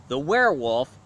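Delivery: usual speaking voice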